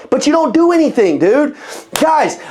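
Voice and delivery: ordinary talk, nothing marked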